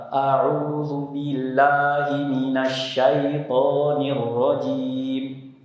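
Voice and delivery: slow recitation with heavy, dark a vowels; thick fatha. Shown as a demonstration. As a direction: chant it slowly with thick fatha. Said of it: "fathahnya terlalu tebal".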